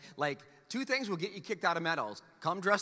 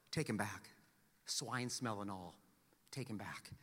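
soft, tender father; 11 semitones lower, 13 dB quieter than usual. Acted. Father's love voiced in parable.

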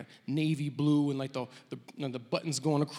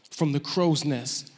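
normal talking voice

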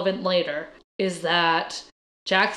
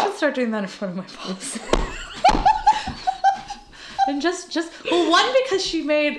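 laughing; 7 semitones higher than usual. Real, not acted. Laughing at own joke.

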